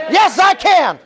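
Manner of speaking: high-pitched call for the crowd to echo; rousing call-and-response cry; rallying shout. Real, not acted. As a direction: lead the chant with fervor. Leading audience in a chant.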